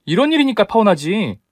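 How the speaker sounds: high-pitched comeback; indignant retort. Acted. Bride insists on calling off wedding, indignant retort.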